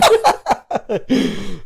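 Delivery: high, loud burst of laughter. Real, not acted. Laughing at liquor store joke.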